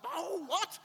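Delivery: high, loud jolted "what" with a laugh; half-asleep dad suddenly jolted alert, laughing; startled wake-up. Retold or imitated. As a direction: snap awake with adrenaline. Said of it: "all of a sudden you're like".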